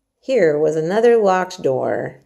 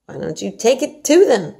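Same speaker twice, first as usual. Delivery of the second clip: suggesting; 5 semitones higher, 6 dB louder than usual. Acted. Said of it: "suggested Mary".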